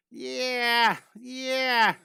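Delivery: loud, high, stretched-out whoops; excited cheer. Real, not acted. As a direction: cheer it out for a Moon mission. Cheering for the Moon mission.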